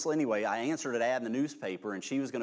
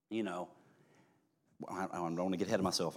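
ordinary talk, nothing marked

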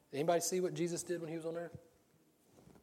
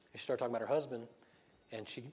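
ordinary talk, nothing marked

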